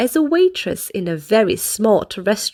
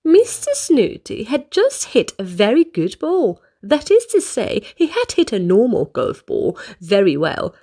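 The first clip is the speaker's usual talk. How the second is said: ordinary delivery, nothing marked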